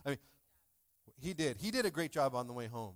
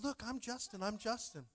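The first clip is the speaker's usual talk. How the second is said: put-on higher, proud little boy showing off; imitating little son; 8 semitones higher than usual. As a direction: pipe it up like a proud three-year-old. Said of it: "He's like".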